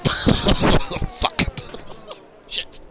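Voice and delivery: helpless laughter broken by swearing; laughing curses. Real, not acted. Streamer whose rant was wasted, swearing through real laughter.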